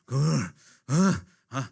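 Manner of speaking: loud fuming huffs; loud huffing breaths. Retold or imitated. Preacher acts out a wife fuming at her husband, huffing loudly. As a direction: huff loudly like a seething wife.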